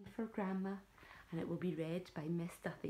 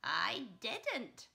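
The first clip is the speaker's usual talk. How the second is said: cackling; 7 dB louder than usual. Acted. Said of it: "cackled the old woman".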